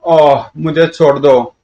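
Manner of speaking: slow, groaning plea; pained pleading. Acted. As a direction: beg for release through the pain. Tortured villain begs release. (दर्द से कराहते हुए गिड़गिड़ाना)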